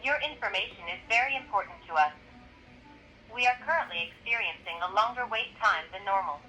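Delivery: recorded phone menu over the line; automated hotline recording. Real, not acted. Automated ICE tip line call.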